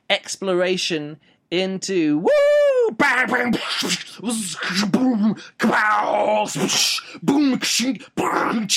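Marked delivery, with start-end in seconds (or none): 2.2-8.7 high, excited mouth-made explosions; mock action noises. Reviewer jokingly acting out the book's themes, explosive mouth noises. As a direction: play out a battle like a kid with toys.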